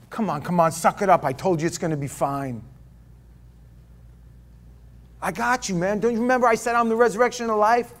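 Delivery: brisk, pushy, fast pep talk; tough-love brush-off. Retold or imitated. Preacher voices an impatient God telling mourners to toughen up, fast and pushy. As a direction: snap them out of it like an impatient coach.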